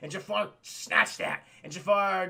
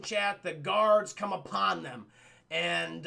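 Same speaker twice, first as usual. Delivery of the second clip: usual speaking voice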